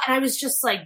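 ordinary delivery

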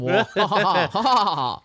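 slow, stretched mock evil laugh; sneering panto villain. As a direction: drag out a hammy villain cackle.

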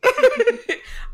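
high bursting laugh. Real, not acted. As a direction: break into loud laughter after the goodbye. Burst of laughter after goodbye.

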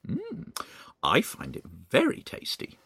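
a hint of a smug old woman, mild sly tone; lightly voiced Missus Twit. Missus Twit watching her husband eat worms, lightly voiced smug. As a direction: savour the lie, only lightly voiced. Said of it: "Missus Twit said".

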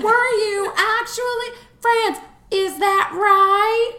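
high, slow, drawn-out questioning; hammed-up high interviewer voice, dragging the words; Goots. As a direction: imitate her incredulous, stretched-out questions. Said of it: "every single time her voice came on".

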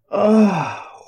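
high dismayed ah; dismayed gasp. A narrator's reading. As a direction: gasp in despair. Villagers' dismay.

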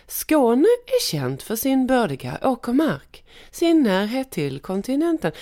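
"Skåne är känt" läst högre, med stockholmsk satsmelodi; härma dialektcoachens stockholmska melodi. Shown as a demonstration. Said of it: "då ville de att jag skulle läsa så här". (läs med stockholmsk satsmelodi som coachen ville)

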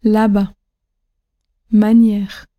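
"Là-bas", "Manière", each accented and falling on the final syllable; là-BAS, maNIÈRE, gently dropping; isolated "Là-bas" and "Manière" with falling endings; last-syllable stress. Shown as a demonstration. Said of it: "descend légèrement".

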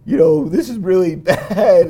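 light mock Italian accent. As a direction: mimic the Italian minister's accent, half laughing. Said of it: "in his Italian accent".

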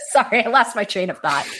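laughing through an apology. Real, not acted. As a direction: chuckle while owning up to the slip. Laughs at losing his thought.

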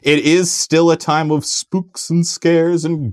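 high, slow, drawn-out spooky delivery; hammed-up spooky announcer. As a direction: stretch the words like a campy ghost-story host. Hamming up Halloween spooks and scares.

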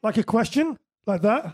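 "question" and "that" rising like questions; uptalk demo. Shown as a demonstration. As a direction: lift the end on "question" and "that". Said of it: "when you go up at the end".

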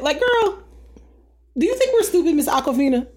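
very high-pitched sassy disbelief; incredulous call-out. Acted. Calling out [name] for thinking we're stupid, high-pitched incredulity. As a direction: pitch it way up, as if insulted. Scolding absent celebrity in mock disbelief.

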